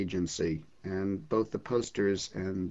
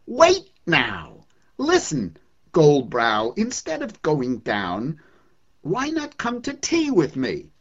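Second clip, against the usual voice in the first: loud, higher, cheeky call; yelling invitation; 5 semitones higher, 7 dB louder, slower than usual. Acted. Poet yelling to the sun to come to tea, loud cheeky call. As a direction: shout up a brash invitation to tea. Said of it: "I yelled at the sun again".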